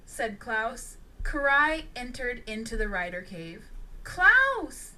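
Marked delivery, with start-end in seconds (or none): 4.0-4.9 loud, high worried call; urgent cry for his attention. A narrator's reading. Karai bursts in calling Klaus, worried and loud. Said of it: "with a worried tone".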